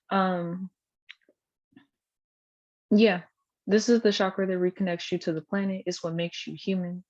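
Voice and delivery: usual speaking voice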